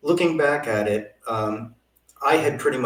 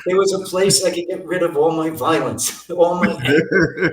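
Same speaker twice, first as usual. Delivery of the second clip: talking through laughter, pitched up; real laughing; 6 semitones higher, 3 dB louder than usual. Real, not acted. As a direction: let laughter break into the words. Joking about sports violence, both laughing.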